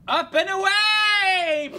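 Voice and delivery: slow heroic shout, loud and high. Acted. Streamer launching the character skyward, heroic shout. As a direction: cry it out like a superhero taking off.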